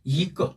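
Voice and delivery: "이" rising, "끄" short in neutral tone; second-tone 이. Shown as a demonstration. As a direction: lift "이", then let "끄" fall light. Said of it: "이 성으로 읽는다".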